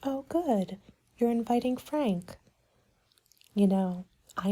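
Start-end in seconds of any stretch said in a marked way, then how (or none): none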